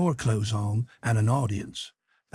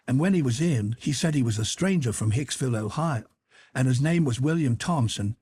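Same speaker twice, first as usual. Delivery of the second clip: ordinary delivery, nothing marked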